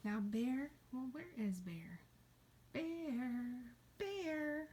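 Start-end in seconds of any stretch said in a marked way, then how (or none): 2.7-4.7 high, drawn-out call. Pretend. Teacher looking for bear, calling out. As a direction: call sweetly for someone out of sight.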